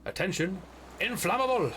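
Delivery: warning shout. Pretend. Reading a warning sign aloud.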